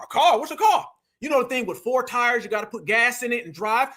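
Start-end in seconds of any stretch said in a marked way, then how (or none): 0.0-0.9 higher, mock-puzzled questions; mock disbelief. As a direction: pretend you never heard of a car. Mocking politician in denial.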